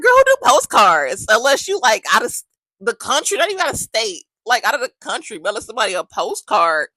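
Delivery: high, loud disbelief at the postcard; incredulous. Retold or imitated. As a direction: cry out as if it makes no sense at all. Shocked friend hears about postcards.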